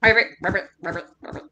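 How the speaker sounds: small ribbits fading to soft and low; frog croaks. Acted. Happy frog helping tell the story, soft little croaks. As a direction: croak quietly, dying away. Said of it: "He's a very happy frog".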